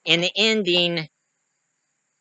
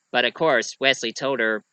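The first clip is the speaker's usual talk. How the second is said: usual speaking voice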